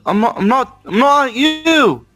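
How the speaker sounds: ordinary delivery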